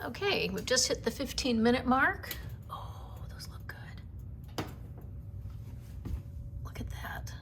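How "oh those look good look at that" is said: whispering. Real, not acted. Admiring scones quietly.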